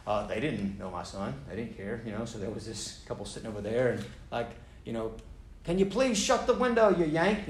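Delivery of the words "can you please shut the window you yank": high, mocking Kiwi accent; sneering Kiwi woman, pitched up; terrible New Zealander. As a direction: put on a bad New Zealand accent to mock the yank. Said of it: "that's my terrible New Zealander, uh, expression".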